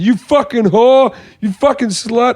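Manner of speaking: high, slow parting insults, imitated; mock yelling. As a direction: re-enact a man ranting on his way out. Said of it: "he starts yelling".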